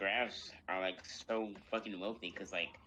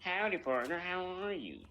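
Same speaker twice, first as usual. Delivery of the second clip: put-on high-pitched cowboy robot with a southern accent; Victor southern accent; 8 semitones higher than usual. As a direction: greet like a folksy southern robot. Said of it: "a robot and a funny southern accent".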